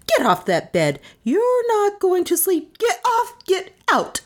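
outraged order climbing very high; shrieking. A narrator's reading. Melissa ordering Carstairs off the bed, shrieking. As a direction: build to a high, furious shriek. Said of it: "she shrieked".

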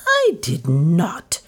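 slow, emphatic, mildly angry denial. A narrator's reading. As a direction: lean on each word, offended. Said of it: "said Lizzie angrily".

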